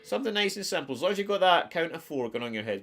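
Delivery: ordinary delivery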